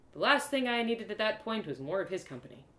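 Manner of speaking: ordinary delivery, nothing marked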